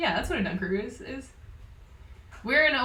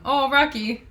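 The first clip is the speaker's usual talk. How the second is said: excited; 6 semitones higher, 8 dB louder, slower than usual. Real, not acted. Greeting dog after dentist.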